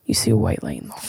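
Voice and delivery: half-whispered, quick and soft; breathy hushed storytelling; hushed scene-setting. Pretend. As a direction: lure the listener into imagining it.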